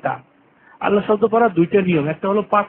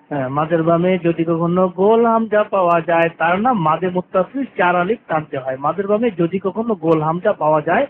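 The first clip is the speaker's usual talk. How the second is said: ordinary talk, nothing marked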